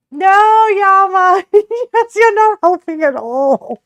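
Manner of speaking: loud, high, laughing protest; complaining to chat, loud and high through laughter. Real, not acted. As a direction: shriek with laughter at unhelpful chat. Protesting chat's choices.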